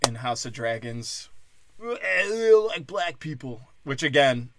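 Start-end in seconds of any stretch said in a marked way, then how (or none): 1.8-3.7 much higher, very slow put-on voice; mock character voice. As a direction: drag it out like a goofy caricature. Mocking a show character.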